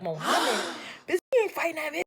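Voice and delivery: a gasp, then a high, fast disbelieving voice; shocked friends. Retold or imitated. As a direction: gasp and blurt it out in alarm. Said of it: "They like".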